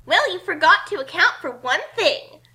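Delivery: loud, confident heroine taunt; boastful heroine. Acted. Kasumi taunting the book leader, loud and confident. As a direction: throw the challenge at the villain.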